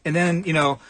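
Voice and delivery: ordinary delivery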